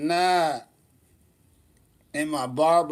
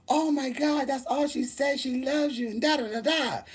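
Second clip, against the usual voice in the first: much higher, gushing fan-mom voice; 10 semitones higher than usual. Retold or imitated. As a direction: squeal it like an excited mother.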